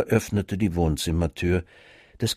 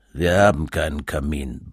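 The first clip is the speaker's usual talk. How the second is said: lower, grumbled reply from behind a newspaper; grumbling father; 7 semitones lower than usual. A narrator's reading. Father behind his newspaper answers the child, grumbling low. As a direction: grumble it without looking up. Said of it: "brummte Vater hinter seiner Zeitung".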